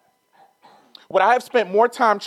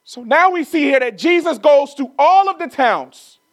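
higher, louder, rising sermon cadence; a climbing, fervent preacher's call; preaching at full force; 8 semitones higher, 4 dB louder than usual. Acted. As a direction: drive the point home from the pulpit. Preaching builds to climax.

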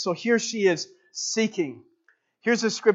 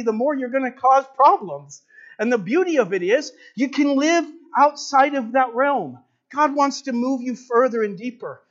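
rising preaching; 5 semitones higher than usual. Acted. Preacher urging husbands and wives, rising and fervent. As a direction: lift the church toward the climax.

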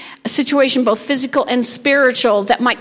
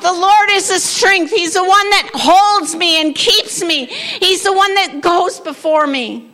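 loud and higher, claiming it with authority; bold declaration; 5 semitones higher, 4 dB louder than usual. Shown as a demonstration. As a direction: declare it over your body like a battle cry. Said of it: "and say".

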